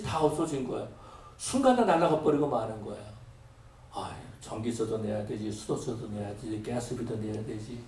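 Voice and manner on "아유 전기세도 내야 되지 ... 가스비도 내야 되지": soft, low weary listing of bills; sighing worry. Retold or imitated. Preacher retelling his young self's bill worries, soft and weary. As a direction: grumble tiredly under your breath.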